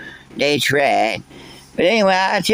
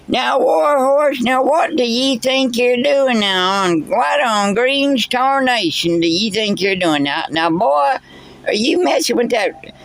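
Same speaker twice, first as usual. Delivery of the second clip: scolding; 6 semitones higher than usual. Acted. Scolding an interrupting listener.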